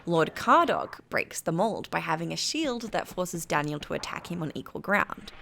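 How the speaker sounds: ordinary delivery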